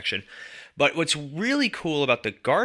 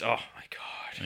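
whispered; 9 dB quieter, slower than usual. Real, not acted. Dismayed aside about the show.

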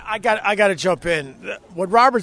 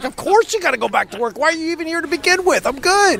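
fast, pitched way up, teasing disbelief; mock exasperation; 10 semitones higher, faster than usual. Telling a busy friend he needn't have come, in high, fast mock exasperation. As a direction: rib him for leaving work to come.

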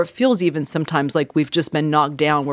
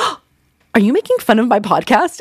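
higher, louder playful gasp; mock surprise; 6 semitones higher, 4 dB louder than usual. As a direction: act offended and amazed. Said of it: "And I was like, gasps".

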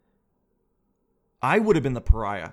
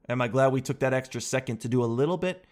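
ordinary delivery

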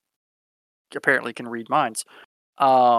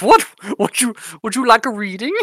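higher, loud offer in a mock Eastern European accent; hammed-up Eastern European mystic offering a reading; 8 semitones higher, 5 dB louder, faster than usual. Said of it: "or Eastern European".

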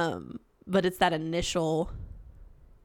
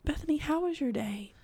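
soft, higher counselor voice; therapist voice; 8 semitones higher, 5 dB quieter than usual. Pretend. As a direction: ask it like a gentle therapist. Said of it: "a therapist voice".

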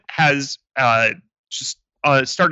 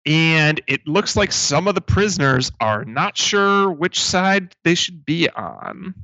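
ordinary talk

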